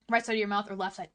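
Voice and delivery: normal talking voice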